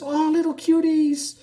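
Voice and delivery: high, drawn-out adoring coo; cooing over children. Acted. Lupe sees the kids playing, cooing high. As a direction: gush slowly in a high voice.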